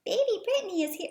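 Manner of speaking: put-on high childlike voice; younger voice. A narrator's reading. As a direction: pitch it up like a small child. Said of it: "In a younger sounding voice".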